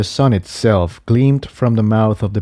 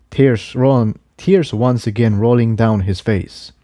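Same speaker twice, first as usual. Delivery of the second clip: plain reading voice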